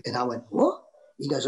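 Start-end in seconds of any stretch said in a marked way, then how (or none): 0.5-0.8 high startled yelp; jolted awake and baffled; sudden high squeak of disbelief; shocked what. Retold or imitated. Woken by phone, shocked.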